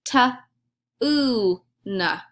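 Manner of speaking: Ta, oo, na said as separate sounds with a long oo; sounding out words. Shown as a demonstration. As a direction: sound out Ta, oo, na one by one. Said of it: "Okay, listen".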